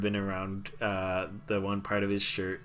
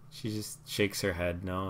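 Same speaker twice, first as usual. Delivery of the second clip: normal talking voice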